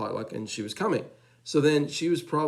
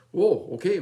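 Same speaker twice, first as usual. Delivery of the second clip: taken aback; 7 semitones higher, 6 dB louder than usual. Retold or imitated. Father startled by child's interruption.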